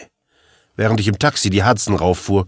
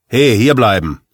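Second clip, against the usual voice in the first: louder, commanding call to halt; sharp order; 3 semitones higher, 5 dB louder than usual. Acted. Cop in charge stopping the narrator, sharp order. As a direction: order him to stay put, louder.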